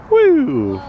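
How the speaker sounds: loud, high whoop; cheering. Real, not acted. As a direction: whoop for the splash. Cheering toddler's splash.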